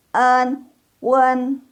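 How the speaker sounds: en, then wen, high and level; built-up wen. Shown as a demonstration. Teacher shows where it comes from, high sustained syllables. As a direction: hold en, then add rounded w for wen. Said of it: "Berasal dari u".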